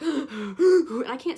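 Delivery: loud gasp then slow breathless words; excited gasp. Real, not acted. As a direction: gasp as he comes near. Star approaching, crowd rushing.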